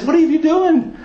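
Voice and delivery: high, indignant retelling without shouting; upset, angry prophet. Retold or imitated. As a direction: act out an upset prophet scolding a king. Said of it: "visibly upset with the king".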